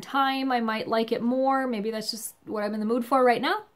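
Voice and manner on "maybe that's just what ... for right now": ordinary delivery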